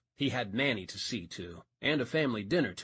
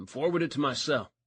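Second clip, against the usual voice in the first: ordinary delivery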